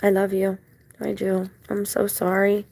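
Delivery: soft, loving words to a dog; tender apology. Real, not acted. Woman apologising to her dog, tender apology. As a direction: quietly comfort the one you love.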